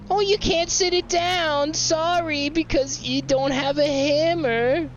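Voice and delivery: high, slow sarcastic mimicry; mock nasal imitation of the game's warning; mocking whiny voice. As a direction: parrot the game in a snotty singsong.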